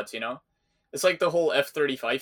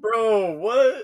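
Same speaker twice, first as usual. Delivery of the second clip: high, loud disbelief; bro, what, squeaked in shock; 9 semitones higher, 6 dB louder than usual. Real, not acted. Reacting to "no one is safe.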